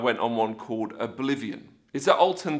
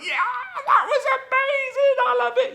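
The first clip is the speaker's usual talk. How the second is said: very high, louder whooping delight; hammed-up exhilarated cheer; acted thrill; 4 dB louder than usual. Rider out the other side, acted thrill.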